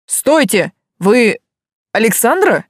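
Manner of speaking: sudden, urgent male caller; urgent man's voice. A narrator's reading. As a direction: call out to stop her. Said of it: "внезапно спросил мужик".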